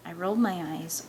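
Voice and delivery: plain reading voice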